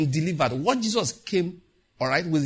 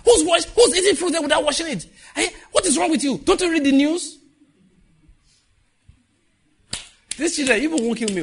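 much higher and louder mock scolding; scolding parent; 13 semitones higher, 7 dB louder than usual. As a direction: scold the children loudly in a put-on voice.

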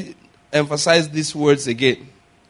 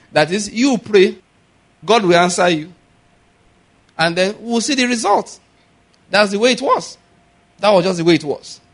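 higher, louder preacher climax; full-force preaching; 6 semitones higher, 4 dB louder than usual. Real, not acted. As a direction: drive the point home.

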